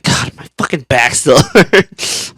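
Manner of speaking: retold aching grumble with a laugh; pained complaint. Retold back pain.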